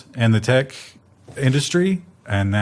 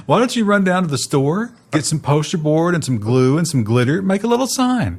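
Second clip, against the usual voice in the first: higher, patronizing mock boss; condescending; 6 semitones higher than usual. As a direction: talk down to a child.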